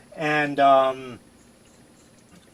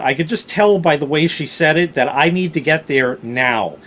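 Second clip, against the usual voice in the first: usual speaking voice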